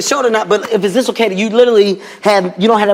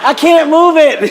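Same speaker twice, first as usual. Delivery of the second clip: mock panic; 7 semitones higher, much slower than usual. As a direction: call out high in pain and panic. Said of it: "I'm like".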